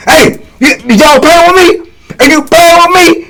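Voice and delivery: high-pitched mock-angry challenge; snapping accusation. Inmate confronting giggling youngins at the table, snapping. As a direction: imitate him confronting gigglers, voice jumped way up. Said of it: "So he snapping".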